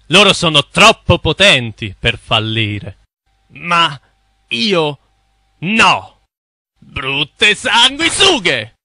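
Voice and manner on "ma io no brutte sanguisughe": drawn-out slow insult; slow contemptuous curse. Acted. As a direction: spit it out slowly. Cursing the bankers.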